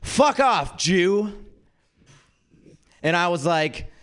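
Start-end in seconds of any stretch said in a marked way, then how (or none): 0.0-1.4 high-pitched mock heckle; imitated street yell. Dude yells at friends across the street, imitated yell. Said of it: "some dude across the street yelled".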